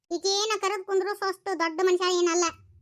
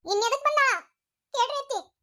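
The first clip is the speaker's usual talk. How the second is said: angry snap; 7 semitones higher than usual. Acted. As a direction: cut her off sharply. Rashmi snaps at Leela.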